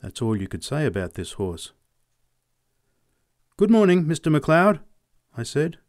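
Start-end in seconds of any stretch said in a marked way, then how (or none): 3.6-4.8 bright raised greeting; cheerful boyish greeting. Acted. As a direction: call out a cheery good morning. Boy greets the farmer.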